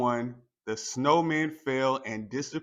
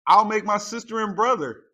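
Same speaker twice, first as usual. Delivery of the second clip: put-on excited child; 8 semitones higher, 8 dB louder, faster than usual. A narrator's reading. Child voiced in story.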